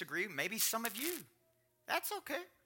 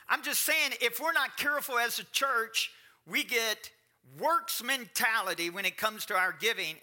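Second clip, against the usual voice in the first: raised, loud preacher's drive; preaching; 8 semitones higher, 7 dB louder than usual. Acted. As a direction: preach it higher and louder to the church. Preaching builds up.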